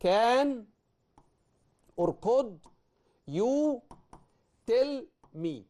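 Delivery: slow, spaced words while writing. Shown as a demonstration. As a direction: pause after each word. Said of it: "لو انا ابتديت وقلت كده".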